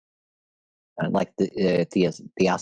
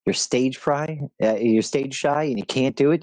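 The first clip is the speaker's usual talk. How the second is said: normal talking voice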